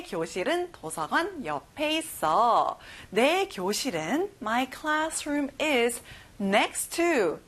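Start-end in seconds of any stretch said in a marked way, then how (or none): 4.4-7.4 slow word by word, landing on "to"; very slow chunk. Shown as a demonstration. Teacher isolates the tricky phrase very slowly. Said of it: "생소하죠?".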